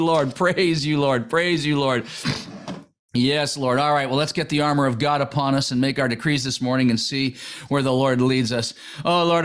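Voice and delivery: fervent morning prayer, low and earnest. Acted. Preacher leading morning prayer, earnest prayer voice. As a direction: pray it aloud, calling on the Lord.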